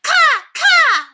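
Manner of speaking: very high, loud bird caw; enthusiastic caw. A narrator's reading. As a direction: caw it like an excited kid. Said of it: "replied Cassiopeia with enthusiasm".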